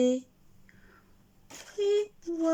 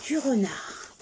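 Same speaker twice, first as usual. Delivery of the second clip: usual speaking voice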